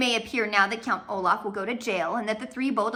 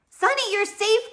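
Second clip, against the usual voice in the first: high, louder cry of relief; joyful cry; 12 semitones higher, 5 dB louder than usual. Acted. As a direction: call out to the little sister in relief. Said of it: "Klaus cried".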